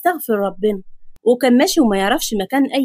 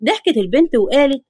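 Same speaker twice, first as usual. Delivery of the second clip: plain reading voice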